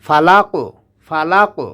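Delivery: ফালাকু with a bounce on the কু; qalqalah on qaf. Shown as a demonstration. Teacher showing the echoing qaf. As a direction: echo the ক in ফালাকু. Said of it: "পাল্টা আওয়াজ দিয়ে পড়তে হয়".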